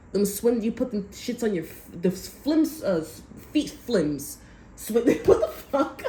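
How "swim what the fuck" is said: high, loud, giving in to laughter; baffled laughing. Real, not acted. Speaker failing to name swim flims, baffled laughing. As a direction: blurt it out as you crack up. Laughs at own failed word search.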